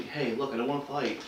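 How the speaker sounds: soft, calming de-escalation voice; quiet and placating, defuse the guy; talking softly. Shown as a demonstration. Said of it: "We talk softly".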